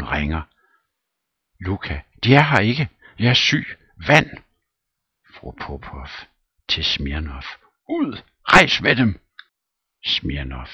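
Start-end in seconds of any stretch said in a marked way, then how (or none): 2.2-4.3 put-on high, loud frantic old man; mock panic, raised; panicky servant. Servant panicking, asks water. 7.9-9.2 very high, loud command; shrieked dismissal; furious order. Acted. Throwing him out.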